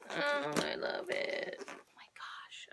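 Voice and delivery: soft unvoiced whisper of delight; awed whisper. Real, not acted. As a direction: whisper it, overwhelmed by cuteness. Gushing over the blanket.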